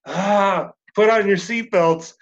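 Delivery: re-enacted thrilled exclamation; awestruck group reaction. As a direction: call it out like bracing for a wild ride. Group reacting to drum fill.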